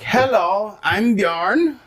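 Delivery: high mock Icelandic greeting; playful Icelandic accent impression. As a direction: put on a goofy Icelandic accent. Mocking an Icelandic man.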